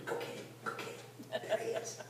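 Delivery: urgent whisper; stage whisper of eager soldiers. Retold or imitated. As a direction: hiss it so nobody in the cave hears. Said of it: "they're whispering".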